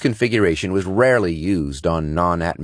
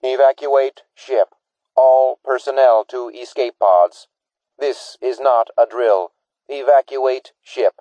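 slow, higher, dispassionate alarm voice; computer voice; 7 semitones higher, 4 dB louder than usual. Acted. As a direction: announce it like an emotionless ship computer. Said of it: "The computerized voice ... dispassionately announced".

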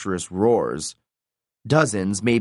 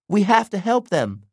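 high-pitched, urgent character voice; distressed plea; 10 semitones higher than usual. A narrator's reading. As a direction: plead for friends in danger. Hazel pleads for trapped friends.

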